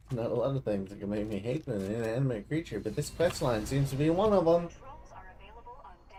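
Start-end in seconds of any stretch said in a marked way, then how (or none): 4.7-6.2 breathless whisper of the prompt; whispered readout. Acted. Gamer reading the game prompt, whispered. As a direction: breathe the line out with no voice.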